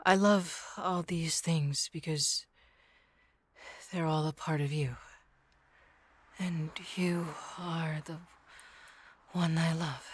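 hushed, slow devotion; slow intimate whisper. Acted. Yandere whispering her devotion to the listener. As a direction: drop to a slow whisper, soft and close.

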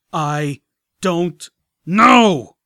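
slow, loud menacing dragon growl; threatening. Acted. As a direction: drag out every word as a threat. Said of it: "sounding threatening".

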